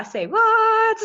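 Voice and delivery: high, loud, slow mock disbelief; surprised. Real, not acted. Host reacting to a viewer comment, playfully astonished. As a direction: stretch it out in playful shock.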